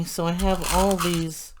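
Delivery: ordinary talk, nothing marked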